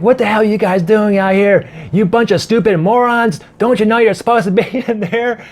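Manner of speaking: high, angry scolding; angry boss voice. Retold or imitated. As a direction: tell them off like a furious old boss. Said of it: "he's like".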